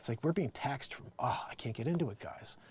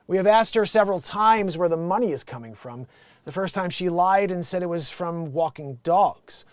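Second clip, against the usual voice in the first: loud, raised read-out voice; letter read aloud; 4 semitones higher, 8 dB louder than usual. Acted. Host reads a Dear Abby headline, projected. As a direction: announce the letter to the audience.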